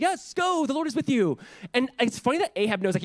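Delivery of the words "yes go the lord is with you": high mocking sarcastic yes. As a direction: drip it with sarcasm. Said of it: "so sarcastically is like".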